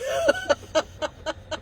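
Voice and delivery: high broken laugh through tears; tearful laugh. Real, not acted. After hearing the charges were dropped, tearful laugh. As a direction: let out a crying laugh.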